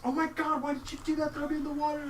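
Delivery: high mock yell at the players; yelling girl. Chantilly yelling at the players who threw her in. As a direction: shriek it like an angry young woman. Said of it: "she's yelling at you".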